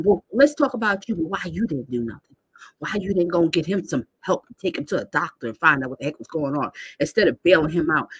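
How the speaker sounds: normal talking voice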